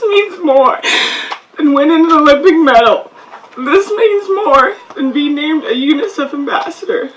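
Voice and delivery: holding back tears while thanking everyone; laughing through tears at her hometown; choked up. Real, not acted. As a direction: voice wavering through tearful laughs. Emotional thanks at hometown ceremony.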